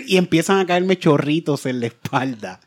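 ordinary talk

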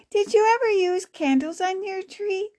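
put-on high-pitched child's question; curious girl. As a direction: pitch it up like a girl asking her grandmother. Child character asks Grammy.

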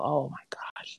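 a breathy whisper of delight. Real, not acted. Guest dreaming about glazed donuts to the host, whispered. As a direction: whisper it like a craving.

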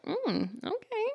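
soft, lilting coy murmur; playful, gentle flirtation; flirty. Shown as a demonstration. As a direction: let it float up high, softly intrigued. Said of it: "like, flirty, but also really respectful".

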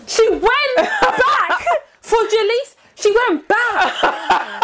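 high, slow words through laughter; laughing disbelief. Real, not acted. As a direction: marvel at it while cracking up. Laughing disbelief about Sinead.

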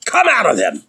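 high, forceful exorcism order; acted commanding cry; authoritative command. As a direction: cast out a spirit with authority. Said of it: "He spoke the authority of the Holy Ghost".

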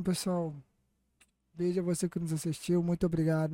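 ordinary talk, nothing marked

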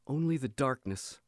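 ordinary delivery, nothing marked

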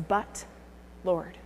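soft, hesitant but Lord; hushed protest. Retold or imitated. Preacher voicing Ananias's doubt to God, hushed. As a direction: barely voice the objection.

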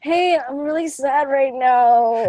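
mock sad whine. Acting sad friend asking.

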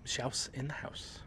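whispered, slow echo of the name. Acted. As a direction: breathe the name back slowly. Echoing the show name.

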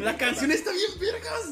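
slow, high laughing. Real, not acted. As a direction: laugh while praising. Laughing praise.

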